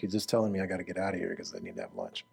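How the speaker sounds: imitating an impatient congregant; impatient listener. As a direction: mutter it like someone eager for lunch. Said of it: "Some of you are like".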